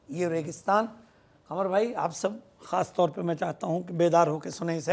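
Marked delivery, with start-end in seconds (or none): none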